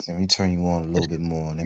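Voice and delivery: ASMR voice. Real, not acted. ASMR voice continues.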